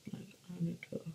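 whispered under breath; barely voiced aside. Real, not acted. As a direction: mutter it to yourself. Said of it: "whispered to self".

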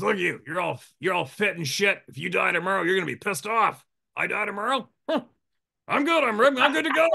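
put-on high, loud, carefree old doctor; imitating the old doctor. The doctor teasing the fit guide, high and carefree. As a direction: toss it off like death is nothing. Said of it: "he goes".

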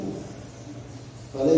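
ordinary delivery

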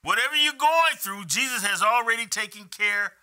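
loud, high, ringing preacher call; preaching at full force. Acted. Pastor rousing the congregation, full-force preaching. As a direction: lift the room to joy.

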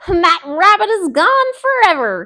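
high loud triumphant cry; cried. Acted. Fox celebrates, loud triumphant. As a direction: crow over the win. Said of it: "cried Brer Fox".

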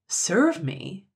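taken aback; incredulous. A narrator's reading. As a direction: throw it back as an astonished question. Incredulous echo of the mannikin.

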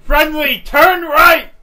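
urgent yelled order; shouting. Real, not acted. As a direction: bark the turn command loud. Urgent steering command in battle.

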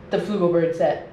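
usual speaking voice

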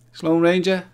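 loud, high cry of delight; delighted shout. Real, not acted. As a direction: call the name out with joy. Excited praise of a viewer.

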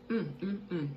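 ordinary talk

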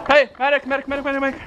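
repeated high calls for medic; man down shout. Real, not acted. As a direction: call the medic over and over. Calling medic for a hit player.

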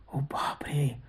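hushed, soft gasp of dread; horrified whisper. Acted. Narrator whispering in horror at ghost's words. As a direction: breathe it like a shiver.

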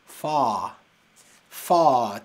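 fa with an open throaty English A. Shown as a demonstration. Teacher to student, demonstrating fa from the throat. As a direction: say fa from the throat, mouth wide open. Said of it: "Tiene como un sonido más de garganta".

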